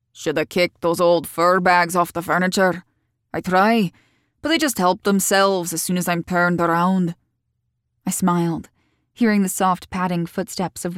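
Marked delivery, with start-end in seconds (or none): 0.1-7.2 lightly voiced local man, casual dialect; easygoing grumble about the dogs, lightly in character; Keats. A narrator's reading. Keats grumbling to a guest about the dogs, lightly voiced.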